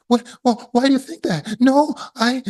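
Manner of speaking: very high, strained bewildered voice; flustered confusion. Retold or imitated. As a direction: caught off guard, sputtering.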